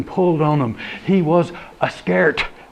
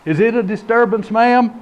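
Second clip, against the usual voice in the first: brisk, higher dispatcher asking questions; quick and official, slightly raised; 6 semitones higher than usual. Acted. Dispatcher asking caller about disturbance, brisk. Dispatcher Sheila answers the call.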